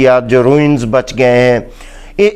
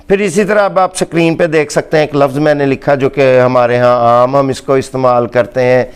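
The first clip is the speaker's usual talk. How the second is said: ordinary delivery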